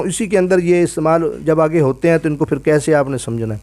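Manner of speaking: ordinary talk, nothing marked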